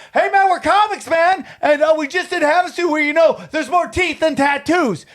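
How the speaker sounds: high, excited mock impression of a friend; botched joke. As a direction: blurt the joke out eagerly, high-pitched. Josh botches the stolen joke.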